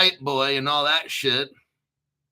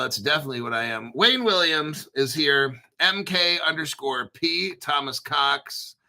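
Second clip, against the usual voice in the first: normal talking voice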